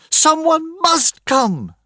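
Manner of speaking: high, slow, desperate shout; mother shouting her plea. Acted. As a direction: demand that help come. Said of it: "she shouted".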